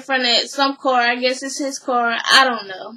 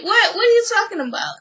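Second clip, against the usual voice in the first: higher-pitched, puzzled comeback; baffled retort; 8 semitones higher, faster than usual. Retold or imitated. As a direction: act out a taken-aback "what?". Retells his baffled reply.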